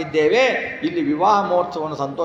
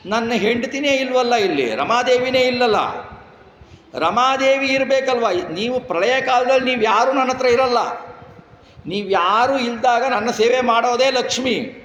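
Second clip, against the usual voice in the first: much higher plaintive complaint; mock lament; 8 semitones higher than usual. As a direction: whine that his wife isn't here. Srinivasa crying for absent Lakshmi.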